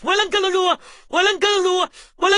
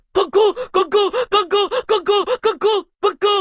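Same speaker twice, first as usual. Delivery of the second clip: loud mocking calls; 3 dB louder than usual.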